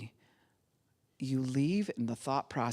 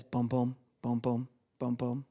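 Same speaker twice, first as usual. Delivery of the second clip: heartbeat imitation; 4 semitones lower than usual. Shown as a demonstration. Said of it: "it sounds like this".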